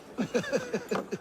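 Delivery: a brief soft chuckle; short chuckle. Acted. Servant chuckling at his jab, soft and brief. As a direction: laugh quietly at your own joke.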